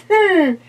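a high, loud wondering hmm; high ghost hum. Acted. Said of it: "said the ghost".

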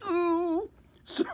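very high squeaky ooh, laughing; a pained high ooh then laughter; wife's wince. Retold or imitated. Wife wincing in the next room, very high ooh. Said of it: "she went".

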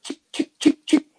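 clicking chick chick, then high and loud; imitated lighter flicks. Describing dealers signalling seats in the theater, lighter flick sounds.

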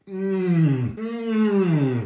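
high, loud hums of pleasure; savoring mmm. Real, not acted. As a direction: hum with delight while chewing. Big forkful just eaten.

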